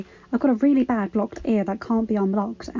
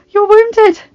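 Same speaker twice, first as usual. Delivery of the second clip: alarmed; 12 semitones higher, 13 dB louder than usual. A narrator's reading. Wife sees husband's wound.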